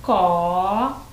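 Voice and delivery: "Es-" and "co-" drawn out, far apart; syllable by syllable. Shown as a demonstration. Teacher sounds out syllables while building the word, syllable by syllable. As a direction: hold each of "Es-" and "co-" long, as for dictation. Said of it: "segunda sílaba".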